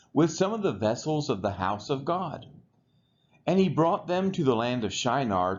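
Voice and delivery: normal talking voice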